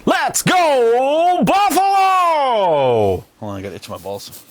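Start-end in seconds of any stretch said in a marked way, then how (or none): 0.1-3.2 long stretched stadium chant; fan shout. Real, not acted. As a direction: bellow it like a crowd chant.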